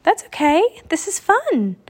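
high, lively reassurance; cheerful excitement. A narrator's reading. Annie enjoying being a raven, cheerful excitement. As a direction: pitch it up with carefree delight.

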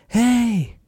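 high put-on voice, slow and concerned; imitating a worried passerby; stranger's concerned voice. Passerby who pulled over asking the crashed couple, concerned high voice. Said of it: "and he's like".

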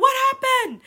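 high, loud, startled dad call; dad impersonation. Retold or imitated. Redoing her dad's call, high and loud. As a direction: yell it like a worried dad. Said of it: "So he could be like".

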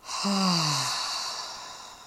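long audible exhale; slow releasing sigh; deep breath out. Shown as a demonstration. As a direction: let a full breath out in a falling sigh. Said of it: "Take a deep breath in".

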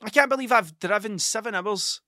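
ordinary talk

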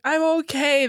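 high, bright "I'm okay"; lightly voiced forced cheerfulness; brave mock cheer. She answers the friend.

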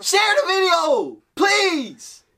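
high, wailing mock plea; at wits end. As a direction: beg like it's life or death. Mock begging viewers to share.

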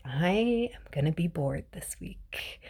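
normal talking voice

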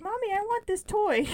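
put-on high child voice, asking for a toy; small child whining for a toy; little girl voice. Voicing small daughter in story.

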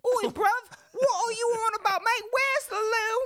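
very high, loud cartoon British; British accent. Host shows off his British accent, shrieky high and loud. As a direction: squeal it like a caricature Brit. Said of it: "my British accent was better".

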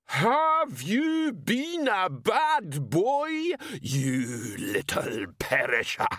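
put-on high, slow menacing old woman; scary mother voice. A narrator's reading. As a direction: loom like a terrifying mam. Ma Lillywhite voiced.

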